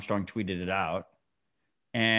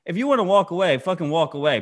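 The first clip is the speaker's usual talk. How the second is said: exasperated shout; 7 semitones higher, 6 dB louder than usual. Real, not acted. Heated rant.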